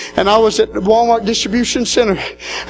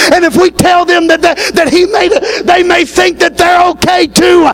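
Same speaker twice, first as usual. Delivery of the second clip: rising preaching climax; 6 semitones higher than usual. Acted. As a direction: drive the final call to the church. Climax of the sermon.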